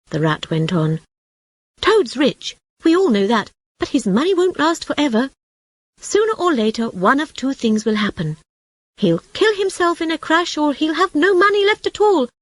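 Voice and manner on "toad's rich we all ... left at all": high, fretful rat voice; worried rat. A narrator's reading. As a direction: complain about Toad, pitched high.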